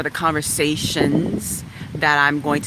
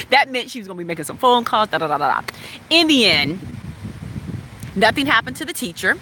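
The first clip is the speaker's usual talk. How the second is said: ordinary delivery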